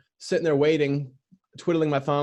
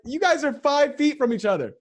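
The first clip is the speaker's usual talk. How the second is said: high, disbelieving exclamation; incredulous; 11 semitones higher than usual. Retold or imitated. Customer scolding staff for not seeing the order, high and incredulous. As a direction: throw up your hands at them in disbelief. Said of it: "I'm like".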